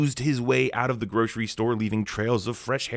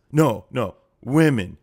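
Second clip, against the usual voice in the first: loud mock self-correction; Rudy Giuliani; 6 dB louder, slower than usual. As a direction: mimic a muddled man correcting himself, louder. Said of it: "Rudy can barely remember what happened".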